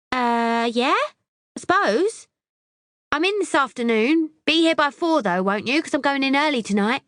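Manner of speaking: high, hesitant then bossy; airy and vague; ditzy girl voice. A narrator's reading.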